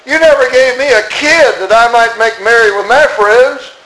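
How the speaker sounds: elder brother complaining to his father, high and aggrieved; resentful complaint. Acted. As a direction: whine it like a cheated son. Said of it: "He said".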